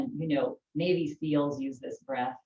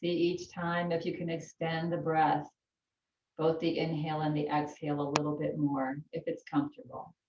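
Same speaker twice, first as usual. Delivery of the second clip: calm yoga teacher voice; unhurried breathing instructions; guided breathing. Acted. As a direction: talk them gently through the breath.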